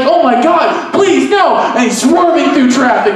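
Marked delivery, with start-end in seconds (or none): 0.0-1.6 higher, louder desperate plea; pleading panic. Retold or imitated. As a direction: beg in rising panic. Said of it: "And he's like".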